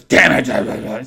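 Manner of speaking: loud imitated cursing; upset. Colleague told to get in line, loudly upset. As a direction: curse loudly, acting out his frustration. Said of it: "So he's all upset".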